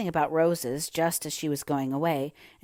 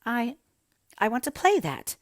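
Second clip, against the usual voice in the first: put-on high, hesitant child voice; stammering; 7 semitones higher, 4 dB louder than usual. A narrator's reading. Mary almost afraid to answer, stammering in a high voice. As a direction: falter on the first word, then push on, pitched high. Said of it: "she stammered".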